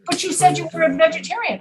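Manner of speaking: high, quick protest; mock disbelief. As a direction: challenge them in acted confusion. Acted comic misunderstanding.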